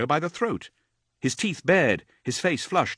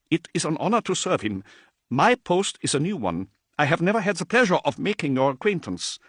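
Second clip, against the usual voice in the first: a hint of a higher, timid messenger; lightly voiced nervous adept; 8 semitones higher, 3 dB louder than usual. Terrified adept addressing Dante, lightly higher and timid. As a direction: introduce yourself with nervous awe.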